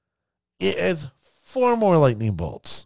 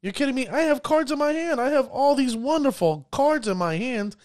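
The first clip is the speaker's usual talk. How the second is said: higher, whiny complaining player voice; annoyed player; 7 semitones higher than usual. Retold or imitated. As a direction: play a frustrated gamer griping about his hand. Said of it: "look at their cards and go".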